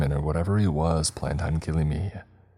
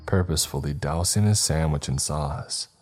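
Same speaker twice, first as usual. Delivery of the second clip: ordinary delivery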